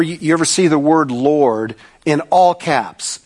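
usual speaking voice

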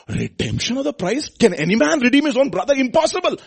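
loud, high, fervent preacher's voice; full-force preaching. Acted. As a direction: proclaim it to the congregation.

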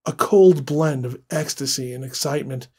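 ordinary delivery, nothing marked

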